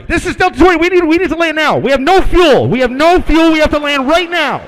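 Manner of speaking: high, loud frantic yell of a desperate pilot; panicked pilot. Retold or imitated. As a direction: scream for the runway, panicking. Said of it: "then we hear our guy".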